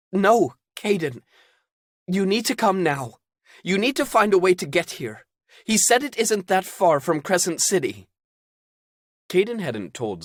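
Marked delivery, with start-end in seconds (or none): 0.1-8.1 firm urgent woman. Acted. Zelda insisting.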